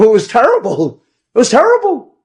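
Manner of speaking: high, loud, rising to a peak; exclaiming in dismay. Real, not acted. Storyteller on the terrible bar mitzvah singing, exclaiming.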